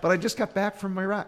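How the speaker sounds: very high, loud laughing plea; whiny husband. Retold or imitated. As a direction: whine like a husband home from a trip. Husband pleading with wife.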